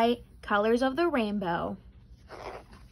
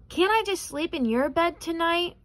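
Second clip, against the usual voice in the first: whining; 6 semitones higher than usual. A narrator's reading. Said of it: "whined Birthday Bear".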